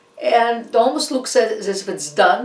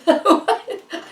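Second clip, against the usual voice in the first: high burst of laughter; 12 semitones higher, 3 dB louder than usual. Real, not acted. Laughing at own messy hair.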